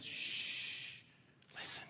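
hushed whisper, no voice. Acted. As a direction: breathe it like a secret. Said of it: "And Higgins says".

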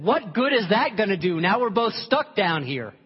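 loud, high exasperated protest. Acted. Trapped man scolds the friend who jumped in, exasperated. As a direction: complain loudly, stretching out the end.

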